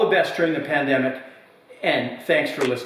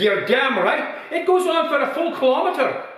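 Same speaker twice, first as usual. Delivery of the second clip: put-on old pub regular's voice pitched high, louder and proud; proud old man; 13 semitones higher, 3 dB louder than usual. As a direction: brag like a weathered old villager.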